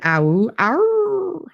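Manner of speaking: high, drawn-out "awoo"; mock wolf howl, held long and high; imitated wolf howl. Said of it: "Are you not in a howling mood".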